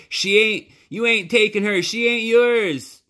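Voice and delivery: put-on a boy screaming at his father over his sister. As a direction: scream it with everything. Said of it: "Bryce screamed".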